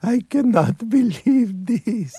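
slow, high, amazed newcomer; wide-eyed wonder. Acted. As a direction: gasp in slow wonder at everything. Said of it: "as if to say".

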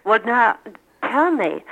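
normal talking voice

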